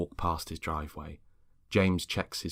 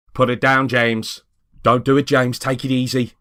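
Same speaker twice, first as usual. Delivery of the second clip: loud, higher warning shouts; urgent shouting; 6 semitones higher, 11 dB louder than usual. Acted. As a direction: order him to drop it, then try to calm him. Neighbours think James attacked Peter.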